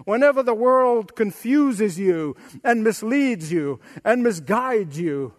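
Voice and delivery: normal talking voice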